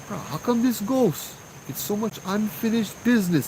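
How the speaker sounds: mock exasperation, pitched high; indignant complaint. Uncle grumbles to Joe about the ghost, mock indignant. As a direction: complain like a put-upon uncle.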